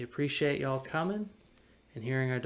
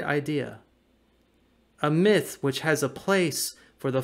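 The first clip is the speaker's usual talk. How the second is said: measured solemn reading of a devotional text; 6 dB louder than usual. Acted. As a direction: read it like a sermon, weighing each phrase. Reads Bonhoeffer devotional text aloud.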